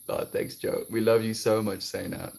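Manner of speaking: usual speaking voice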